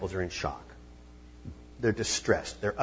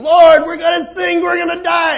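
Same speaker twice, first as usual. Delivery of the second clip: shouted, much higher panicked plea; panicked cry; 16 dB louder than usual. Retold or imitated. Preacher voicing terrified disciples in the storm, panicked shout. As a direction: yell it like sailors about to sink.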